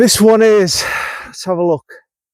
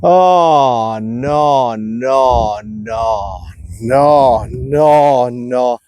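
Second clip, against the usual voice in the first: despairing groan of no's; 6 semitones lower, much slower than usual. Real, not acted. Golfer watching his ball go astray, dismayed groaning. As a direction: groan it like the shot is lost. Bad shot, groaning at himself.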